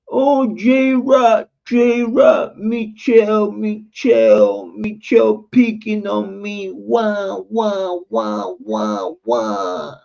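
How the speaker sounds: high whining mock voice; mocking whiny complainer. Mocking the people who came to tattle, high whiny voice. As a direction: whine like a tattling crybaby. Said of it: "they used to come to me".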